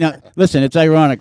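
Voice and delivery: loud through laughter; loud laughing restart. Real, not acted. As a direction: push on while cracking up. Host laughing loudly.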